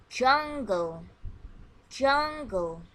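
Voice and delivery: loud clear model word said twice for echo; teacher modelling a vocabulary word. Shown as a demonstration. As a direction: call out the word for the class to repeat. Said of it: "Listen and repeat after me".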